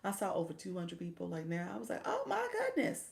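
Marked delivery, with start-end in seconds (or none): 2.0-3.0 very high, laughing gasp of delight; retold thrilled squeal; excited squeal. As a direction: squeal at the viewer count. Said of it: "my voice gets a little excited".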